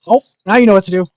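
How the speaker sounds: high, loud, fast delighted coo; excited dog talk. Real, not acted. Owner delighted as the dog jumps up, fast and high. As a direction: rush it out, delighted.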